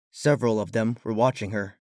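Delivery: plain reading voice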